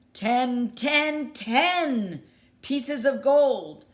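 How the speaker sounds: loud, high, slow triumphant pirate bellow; pirate shout. Acted. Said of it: "shouted Pirate Ten".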